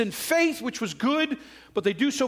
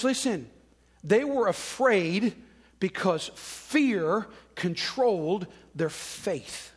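usual speaking voice

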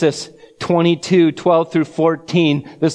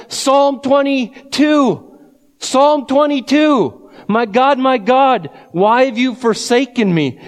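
screamed cry from the cross; 8 semitones higher than usual. Retold or imitated. Preacher voicing Jesus crying out on the cross, anguished shout. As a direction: cry it out in agony to God. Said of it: "And Jesus screams out".

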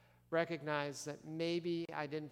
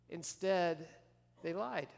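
ordinary talk

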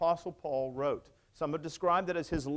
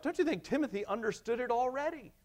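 ordinary talk

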